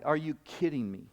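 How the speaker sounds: normal talking voice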